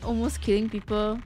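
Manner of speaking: normal talking voice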